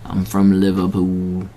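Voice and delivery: a bad, soft Liverpool accent, said slowly like a [name]; a lightly put-on Liverpool [name] accent on "I'm from Liverpool". Shown as a demonstration. A sports host mocks his own Liverpool accent, with a bad, soft [name]-style Liverpool accent. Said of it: "I say it like I was a [name]".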